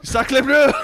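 high, loud hammed-up French cry; mock French exclamation. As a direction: throw out an outraged French oath.

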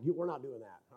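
very low, hushed refusal; quiet retold refusal. Husband refusing wife on the couch, quiet refusal. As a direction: imitate putting the foot down almost under the breath.

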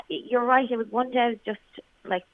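usual speaking voice

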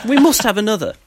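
high, insistent we must; jolly German host. Retold or imitated. Fan imitating the German who buys beers, high and insistent. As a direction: push another round like a keen host.

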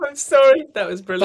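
real helpless laughter; laughing shout. Real, not acted. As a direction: burst out cracking up. Guest cracking up at limerick.

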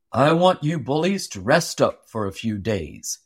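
low, hearty voice of a big boss with a twinkle; Paul the giant boss. A narrator's reading. Paul tells the seven axemen to rest, low and sly. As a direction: speak low and sly, hiding a plan.

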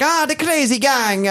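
put-on high, slow Italian jockey accent. Frankie Dettori greeting the Wimbledon team, in an Italian accent. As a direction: lay on a sing-song Italian accent. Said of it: "he does kinda talk like that. He's Italian".

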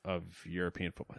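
ordinary talk, nothing marked

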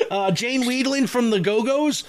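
ordinary delivery

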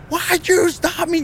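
very high, drawn-out protest; retold yell. Suspect interrupting the officer's instructions, high wailing mock yell.

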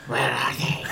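gruff Batman growl. Pretend. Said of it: "in Clement's voice".

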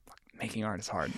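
weary whispered complaint; exasperated whisper. Retold or imitated. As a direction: whisper it like a tired artist giving up. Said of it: "She'll be like".

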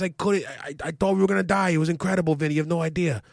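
high, excited retelling of the crash; a high-pitched voice, wide-eyed and shaken; imitating his cousin and uncle. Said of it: "he's like".

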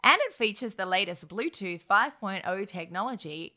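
ordinary talk, nothing marked

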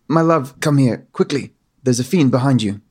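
lightly posh English princess calling; slightly posh English. Acted. Princess warning her fiancé, lightly posh English accent. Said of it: "slightly posh English".

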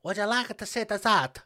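hammed-up accent of no known country; unidentifiable put-on accent. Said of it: "I don't know what accent it".

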